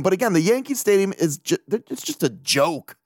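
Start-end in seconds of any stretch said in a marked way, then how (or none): none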